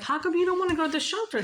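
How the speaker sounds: quick, high acted-out question; high-pitched questioning. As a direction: press him in a high, hurried voice. Acts out her questioning.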